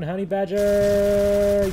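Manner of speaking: loud drawn-out shout; shouted slow. Real, not acted. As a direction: yell the name out slowly. First callout, shouted.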